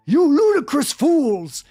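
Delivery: high, loud, slow mock bellow; hammed-up furious yell at fools; theatrical angry shout. A narrator's reading. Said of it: "He kept shouting at us angrily".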